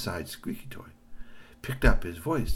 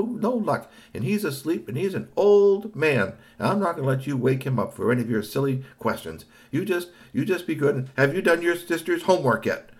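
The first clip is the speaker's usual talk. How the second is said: high scolding mother; scolding mom voice; 7 semitones higher, 4 dB louder than usual. A narrator's reading.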